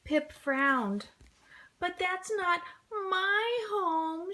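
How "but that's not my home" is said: high, slow, close to crying; tearful. A narrator's reading. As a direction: sad little penguin, tearing up. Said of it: "Pip near tears".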